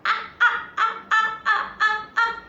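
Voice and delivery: repeated "ah" said high and loud; letter sound ah. Shown as a demonstration. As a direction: model "ah" like the shouting children. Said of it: "let's do the action".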